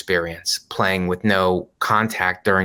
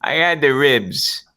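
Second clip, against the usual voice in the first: higher, slow, hammed-up delivery; put-on punchline voice; 5 semitones higher, slower than usual. Son telling his mother what he ate, hammed-up joke voice. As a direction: draw out the joke in a silly voice.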